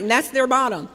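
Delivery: normal talking voice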